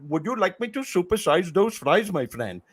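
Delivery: lightly voiced Indian drive-thru worker; Indian accent. Comic retelling his stand-up bit, in a light Indian accent. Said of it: "that's the bit. That's the way he sounded".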